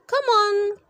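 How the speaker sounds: high, loud call to escape; crying out. Acted. Boy to friends, calling out to flee the lab. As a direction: urge your friends to run. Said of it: "cried Harold".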